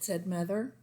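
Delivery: usual speaking voice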